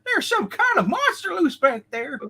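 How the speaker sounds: put-on high Southern old prospector voice. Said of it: "there's the old prospector".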